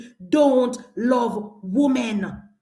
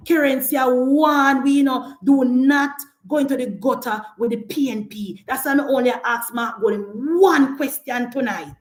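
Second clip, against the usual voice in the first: ordinary talk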